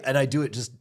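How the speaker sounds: usual speaking voice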